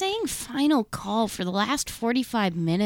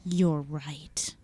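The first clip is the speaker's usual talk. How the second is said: whispering; 7 semitones lower, 4 dB quieter than usual. Acted. Ex agrees in aside.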